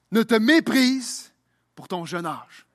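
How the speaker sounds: ordinary delivery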